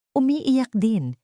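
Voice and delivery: ordinary delivery, nothing marked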